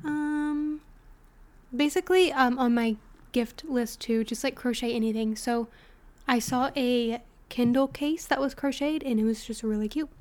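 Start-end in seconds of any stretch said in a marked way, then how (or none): none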